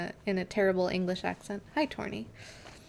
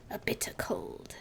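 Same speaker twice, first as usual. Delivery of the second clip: breathy hushed whisper of a spooky title; mock spooky whisper; 10 dB quieter than usual. Streamer reading creepy chapter titles to chat, mock spooky whisper. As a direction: whisper it like a ghost story.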